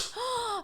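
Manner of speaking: sharp gasp. Retold or imitated. Retelling seeing him smile.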